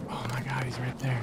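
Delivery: tense whisper; panicking gamer. Real, not acted. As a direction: whisper it, scared of being heard. Enemy right beside him.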